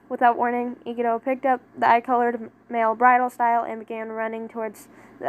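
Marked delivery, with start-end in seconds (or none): none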